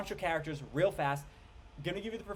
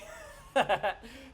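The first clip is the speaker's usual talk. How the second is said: a higher, bright burst of laughter; real laugh; 8 semitones higher, 4 dB louder than usual. Real, not acted. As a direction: laugh out loud at the joke. Laughing at the joke.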